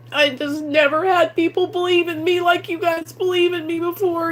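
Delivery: fighting back tears while thanking them; tearful. Real, not acted. Vlogger thanking subscribers for believing in her, tearful and breaking. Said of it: "Thanks for listening to me cry".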